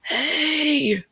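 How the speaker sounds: high, strained voiceless yell; hoarse failed yell. Retold or imitated. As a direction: try to yell with no voice. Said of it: "if I tried to yell".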